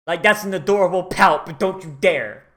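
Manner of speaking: ordinary talk, nothing marked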